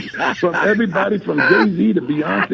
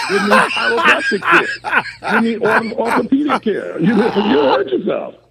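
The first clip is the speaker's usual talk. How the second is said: mock concern; 5 semitones higher than usual. Real, not acted. Mocking someone who hurt themselves.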